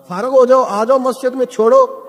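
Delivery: urging call. Acted. Preacher urging rich elders.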